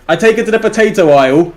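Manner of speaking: ordinary talk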